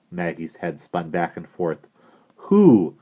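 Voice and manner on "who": high, loud, urgent "who"; baffled question. A narrator's reading. Maggie demands the newcomer's identity, loud bewildered question. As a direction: blurt it out in bewilderment.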